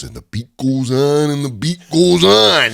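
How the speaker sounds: slow raspy chant; Macho Man impression. Macho Man's catchphrase chanted slowly and raspy. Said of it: "he comes in and he goes".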